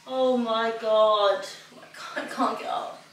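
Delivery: slow groan fading into voiceless gasps; exhausted. Real, not acted. Spent runner unable to get up after finishing, exhausted. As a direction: drag it out, then lose the voice. Collapsed on the floor after run.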